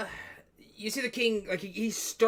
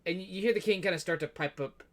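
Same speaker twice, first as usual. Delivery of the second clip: ordinary talk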